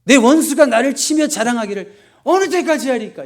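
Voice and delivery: loud, high angry outcry to God. Acted. David complaining to God about his enemy, angry. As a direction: cry out the verse in indignation. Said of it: "약간 화를 내는 거예요".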